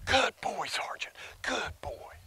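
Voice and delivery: hushed, tender praise for the dog; soft loving praise. Shown as a demonstration. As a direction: softly praise him, not to disturb. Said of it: "walk over there and".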